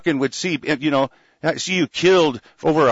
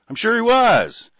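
sarcastic; 8 semitones higher, 3 dB louder than usual. Real, not acted. Doubting the "model prisoner" line.

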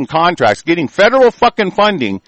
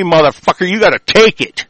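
ordinary talk, nothing marked

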